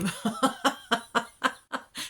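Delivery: high, loud laugh; laughter. Real, not acted. Reader laughing at her own emotional chaos.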